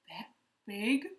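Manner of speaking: a touch of nervous gulping. A narrator's reading. Elephant gulps, mildly scared. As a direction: say it swallowing hard. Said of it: "gulped the elephant".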